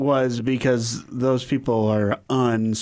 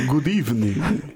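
put-on Transylvanian vampire accent, half laughing. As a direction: greet them like a Transylvanian count. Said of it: "a thick Transylvanian accent".

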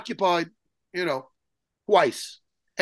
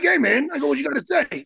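higher, challenging call-out; confrontational challenge; 6 semitones higher than usual. Retold or imitated. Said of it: "And I'm like".

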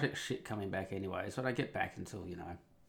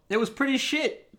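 laughing disbelief; 6 dB louder than usual. Real, not acted. Laughing at his own bad weekend.

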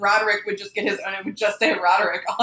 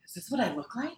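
very soft, awed question; hushed amazement; 11 dB quieter than usual. Retold or imitated. Imitating the stoned actress staring at her face, hushed awe. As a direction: breathe it out, stunned. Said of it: "she's like".

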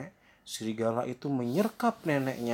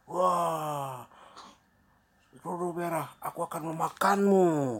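raised, loud threatening howl; menacing wolf; 6 semitones higher, 4 dB louder than usual. Acted. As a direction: threaten to gobble her up, swelling at the end. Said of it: "kata serigala".

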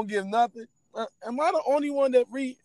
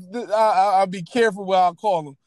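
usual speaking voice

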